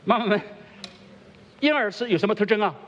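calling out to mothers. Real, not acted. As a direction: call it out across the room. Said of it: "妈妈们讲".

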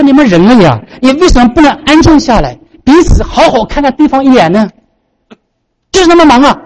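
high-pitched anguished plea; acted crying out. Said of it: "后来他就大喊".

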